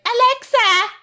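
high, loud mock Cork-Longford accent; Cork-Longford mixed accent. Imitating sister calling her Alexa, loud Cork-Longford accent. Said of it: "a kind of a Cork Longford mixed accent".

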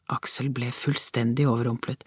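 ordinary delivery, nothing marked

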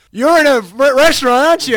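high, loud mock phone shout; excited retold shout. Bandmate finally getting through on the phone, excited shout.

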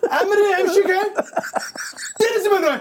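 high, slow mock Arabic gibberish; hammed-up angry Arabic imitation; fake angry Arabic.

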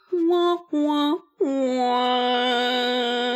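high whiny fake crying; mock baby crying. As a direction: imitate a whining baby. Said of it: "He's like".

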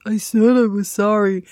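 loud, drawn-out insistent sorry; put-on indignant apology, slow and loud; imitated child's defiant apology. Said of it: "Mikey went".

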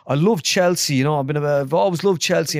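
put-on a keen young player thrilled on the phone; excited footballer. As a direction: gush about the club like a starstruck player.

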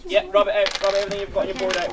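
high, quick classroom instruction; primary teacher giving directions; teacher to class. Real, not acted.

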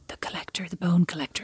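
whispering the thriller title for effect; spooky whisper. Acted. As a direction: hush it like a thriller trailer. Dramatic film title aside.

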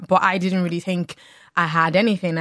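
ordinary talk, nothing marked

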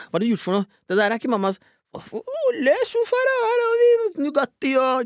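high, squeaky astonishment; amazed little kid. Retold or imitated. Boy seeing the donated food, squeaky amazed delight. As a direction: gasp it out like a delighted child. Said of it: "Så jeg bare".

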